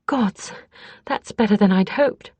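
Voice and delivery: plain reading voice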